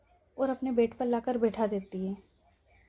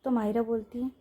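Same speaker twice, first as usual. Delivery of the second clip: plain reading voice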